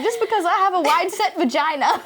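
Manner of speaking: high, giggly movie quote; laughing Mean Girls line. Retold or imitated. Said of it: "What's that girl off Mean Girls say?".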